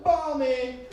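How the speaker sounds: high-pitched call for the ball; cocky court call-out. Acted. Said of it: "walk onto a basketball court and be like".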